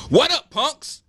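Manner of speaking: high, loud casual hello to the class; cool teacher greeting. A narrator's reading. Teacher greeting his class with a high, casual call. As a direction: toss it off like a laid-back teacher.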